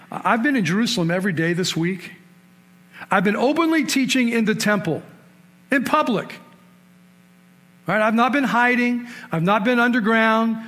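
Jesus confronting the temple guards, preaching at full force. Acted. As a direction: preach it hard, hammering each line.

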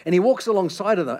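ordinary talk

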